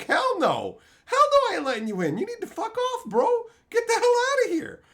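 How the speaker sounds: high, loud, fast outraged shout; mock yelling refusal. Yelling at the crackhead through the bathroom door, loud and high. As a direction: yell him away from the door.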